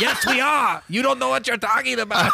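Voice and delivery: high-pitched indignant German couple impression; mock yelling. Old German couple telling off a teenager, high mock yell. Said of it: "they yelled at me for a long time".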